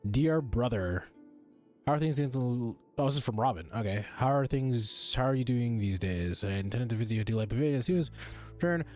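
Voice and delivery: ordinary talk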